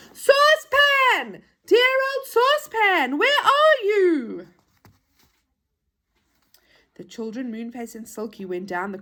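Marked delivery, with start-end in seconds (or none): 0.1-4.5 high, long-drawn searching shout; loud calling. Acted. Children shout for lost Saucepan as they go, calling out. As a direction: call out loudly, stretching each word. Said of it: "shouting loudly as they went".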